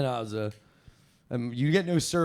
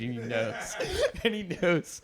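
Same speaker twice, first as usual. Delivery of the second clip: usual speaking voice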